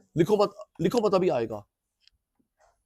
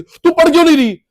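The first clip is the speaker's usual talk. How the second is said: high, loud parental telling-off; scolding parent; 10 semitones higher, 7 dB louder than usual. Acted. Mother snaps at child caught not studying, acted scolding. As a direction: burst out scolding, pitch jumping up.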